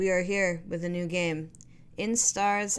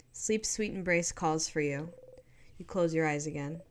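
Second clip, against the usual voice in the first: ordinary delivery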